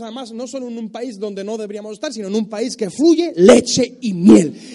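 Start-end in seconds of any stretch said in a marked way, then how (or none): 2.1-4.8 much louder, rising preaching cry; full force preaching. Acted. Preacher promising blessing, full force. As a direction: proclaim blessing to the crowd.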